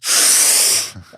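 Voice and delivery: loud gulping inhale shown as a demo; big loud breath in. Shown as a demonstration. Showing the doctor a huge noisy breath, big inhale. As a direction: heave in a huge audible breath. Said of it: "that's a big breath or a full breath".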